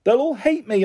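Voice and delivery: put-on high, despairing child's wail; upset, high-pitched wail. A narrator's reading. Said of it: "I wailed".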